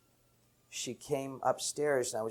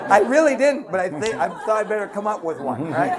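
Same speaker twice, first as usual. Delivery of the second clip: laughing; 6 semitones higher, 12 dB louder than usual. Real, not acted. Laughing at own story.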